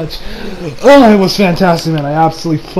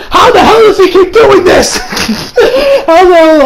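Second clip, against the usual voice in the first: much higher, louder laughing shout; 12 semitones higher, 5 dB louder than usual. Real, not acted. Hyped fan shouting at Toriyama, high and laughing. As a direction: shout in delighted disbelief.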